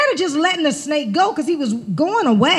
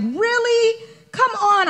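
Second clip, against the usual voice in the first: exasperated disbelief; 8 semitones higher, slower than usual. Retold or imitated. Exasperated at wiggling sleeping bag.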